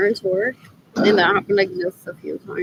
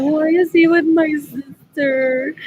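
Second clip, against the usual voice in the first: slow, high whining mock sob; hammed-up jealous whimper; mock whiny crying; 5 semitones higher, 4 dB louder, much slower than usual. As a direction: whine it like a jealous kid about to cry. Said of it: "gonna cry and be like".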